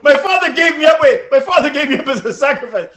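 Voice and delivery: loud, high, laughing protest; laughing outcry. Real, not acted. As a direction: shout it through laughter. Laughing at own story.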